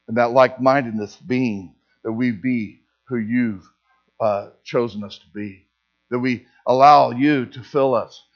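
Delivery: low, hushed pastoral prayer. Acted. Pastor praying over the church, hushed and low. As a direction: pray it gently, head bowed.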